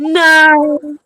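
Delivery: loud, high, drawn-out no; long high wail of mock dread; mock dismay. Real, not acted. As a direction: wail a long playful protest. Mock dread at co-host's announced topic.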